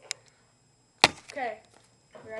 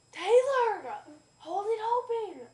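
higher, louder panicked call; urgent shout; 7 semitones higher, 9 dB louder, slower than usual. Real, not acted. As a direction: yell for her to hurry. Grasshopper about to jump, urging.

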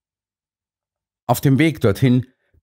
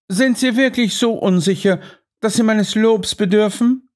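lightly voiced Holmes, much higher; mildly mocking question; Holmes teasing; 11 semitones higher than usual. A narrator's reading. (Holmes spöttelnd, deutlich höher)

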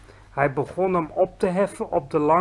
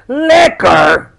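loud high outraged bellow; roared in outrage; 6 semitones higher, 13 dB louder than usual. A narrator's reading. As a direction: bellow the word back at him. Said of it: "brulde de bloedbottelaar". (luid verontwaardigd gebruld)

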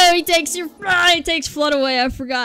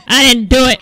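loud, insistent denial; mock protest; 10 dB louder than usual. Gamer pretending to deny blame to police, loud. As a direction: protest your innocence.

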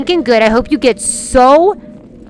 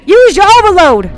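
loud, high command; raised-voice urgent order; exasperated yell; 6 semitones higher, 7 dB louder than usual. Real, not acted. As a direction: yell the order at a useless teammate. Yells order at game companion.

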